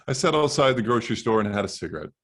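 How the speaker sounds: ordinary delivery, nothing marked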